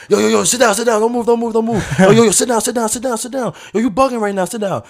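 hoog, luid herhaald bevel om te gaan zitten; dringend roepen. Retold or imitated. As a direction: roep paniekerig dat hij moet blijven zitten. Stranger orders hurt boy down.

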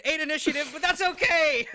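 high, loud outburst; real high-pitched shout; loud exclamation. Real, not acted. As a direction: cry it out over a poor roll. Player reacts to a poor roll.

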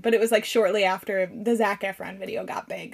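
normal talking voice

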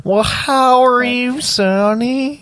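very high, drawn-out granny voice; hammed-up high old lady; nursing-home old woman. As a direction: stretch it out like a doddering granny. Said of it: "the old women in the nursing home".

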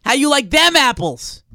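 loud, slow Boston challenge; taunting Boston accent. Acted. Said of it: "picture that you're in a scene in Good Will Hunting".

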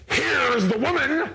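high, slow mock voice; imitating the big man. Psychiatrist presenting the woman, high and slow. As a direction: drag it out in a comic high voice. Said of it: "He just goes".

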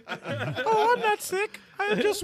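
put-on high falsetto girl gamer; mock girly voice. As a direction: squeak it up like a girl.

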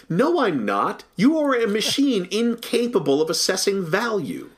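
mock-offended rebuttal to a talking box; indignant retort. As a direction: snap back at the checkout, with a laugh breaking in. Retorting to a self-checkout machine.

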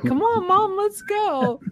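high, excited puppy voice; eager dog voice. Pretend. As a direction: speak for the dog, bouncing with eagerness.

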